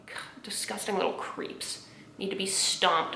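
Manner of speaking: hushed, whispery contempt; disgusted mutter. Real, not acted. Speaker on homophobes, disgusted whispery mutter.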